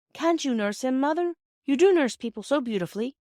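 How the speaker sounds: put-on a boy pleading his case with his mother; hint of an excited schoolboy; eager boy. Narrator voices eager boy.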